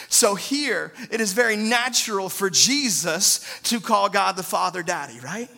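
normal talking voice

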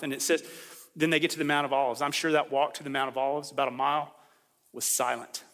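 normal talking voice

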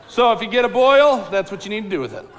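ordinary delivery

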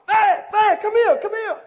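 high, loud call across the house; mother shouting her son's nickname to come; mama calling out. Retold or imitated. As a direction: yell for the kid from the other room. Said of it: "she would call me".

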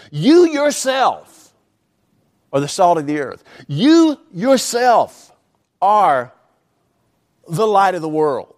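slow emphatic You yourself before each clause; stress on YOU YOURSELF. Shown as a demonstration. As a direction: lean hard on You yourself, then pause. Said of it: "the best way to translate them is this".

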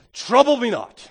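loud, firm stand against the accuser; bold declaration. Pretend. Preacher voicing a believer facing security, defiant. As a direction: hold up the receipt and declare it. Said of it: "and say".

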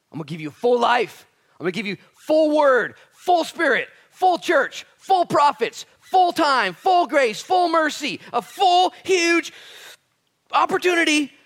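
high, full-force shouted list; hammering preacher's crescendo; impassioned promise. Acted. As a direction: punch each item at a shout. Voicing God's impassioned promise.

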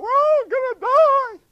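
high, loud panicked cry; panicked sailors. Retold or imitated. Crew on the ship panicking in the storm, shrieking high and loud. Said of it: "the rest of the ship freaked out".

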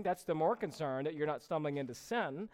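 ordinary delivery

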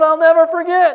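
cornered student apologizing over the yelling; loud flustered apology. Retold or imitated. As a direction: blurt out the apology at full pitch. Student promising amid yelling.

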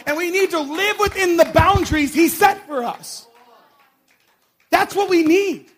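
loud, high, fiery sermon climax; preaching at full force. Acted. Preacher rousing the congregation, shouting at full force. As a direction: preach it out to the whole room, building.

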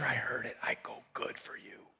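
very soft, whispered exchange; hushed whisper. Retold or imitated. Woman and pastor on what she heard, whispered. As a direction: drop right down to a whisper.

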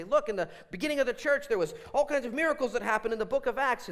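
high, loud, insistent quoting; mock argumentative. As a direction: imitate someone overeager to prove a point. Mimics silly Christians arguing from verses.

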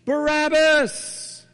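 high, drawn-out shout of the name; crowd shout. Retold or imitated. Preacher voicing the crowd, a long high shout. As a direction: bellow it like a whole mob. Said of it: "the crowd shouts in unison".

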